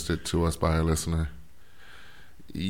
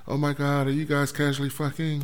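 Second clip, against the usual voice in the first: gushing fan voice; 10 semitones higher than usual. Retold or imitated. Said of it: "emails have we gotten that says".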